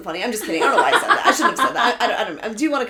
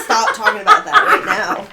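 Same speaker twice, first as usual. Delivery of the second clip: high, loud, laughing mock scolding; mock stern shout; 12 semitones higher, 8 dB louder than usual. Friend mock-scolds the host for talking about the trademark, laughing shout. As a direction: pretend to hush them, laughing.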